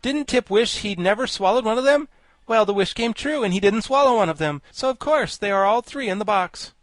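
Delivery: high, loud character voice; high-pitched lecturing insect; Wogglebug, pedantic logician. A narrator's reading. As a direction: argue it out emphatically.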